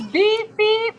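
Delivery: imitated high censor beeps. Listener joking about censored stage videos, high beep noises. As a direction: beep out every curse in a high voice. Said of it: "if I had to censor this".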